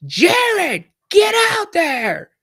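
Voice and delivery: high, loud, slow bellowed order; coach's angry yell. Retold or imitated. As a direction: roar it like a furious coach grabbing a shirt. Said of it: "and he goes".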